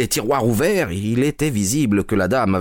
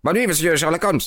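higher-pitched greeting tossed in passing; passer-by's greeting; 9 semitones higher than usual. A narrator's reading. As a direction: call goodnight lightly in a higher voice. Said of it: "un passant lui lança". (salut lancé au passage, voix plus aiguë)

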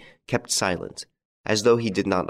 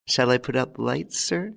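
deferential butler asking his master; higher, lightly voiced servant's question; a polite servant's query, lifted on "sir"; 4 semitones higher than usual. A narrator's reading.

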